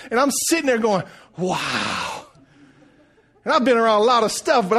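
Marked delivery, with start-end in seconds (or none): 1.4-2.3 low, drawn-out amazement; awestruck wow. Retold or imitated. Man retelling watching the carpenter work, awestruck wow. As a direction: stretch it out in wonder.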